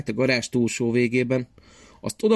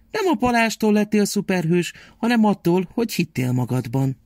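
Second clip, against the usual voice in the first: high, caring grandmother voice; loving grandma; 4 semitones higher than usual. Acted. Grandma comforts sad Szaszi, high loving voice.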